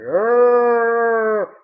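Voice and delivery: loud, far-off moaning groan; cultist groan. Retold or imitated. Mocking cloaked cultists with a loud groan. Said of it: "They're like".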